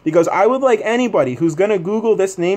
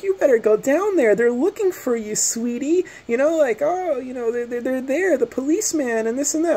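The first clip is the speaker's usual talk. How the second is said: high, syrupy imitation of her; imitating the neighbor's sugary taunt; mock sweet lady; 8 semitones higher, 3 dB quieter than usual. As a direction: mimic her patronizing sweetness. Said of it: "she's just talking to me like".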